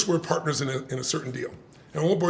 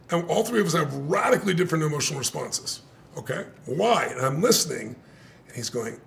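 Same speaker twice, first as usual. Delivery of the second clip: ordinary talk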